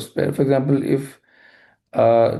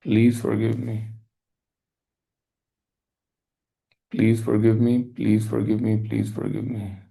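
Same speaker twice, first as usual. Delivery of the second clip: guided meditation; 4 semitones lower than usual. Acted. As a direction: lead the listener gently into forgiveness. Leads a forgiveness meditation.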